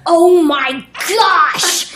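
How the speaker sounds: loud, high, long-drawn exclamation; acted inner scream. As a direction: let the joy burst out, every word stretched. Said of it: "I was screaming my heart out".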